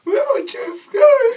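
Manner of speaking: mock wail. Lost tooth, playful wail.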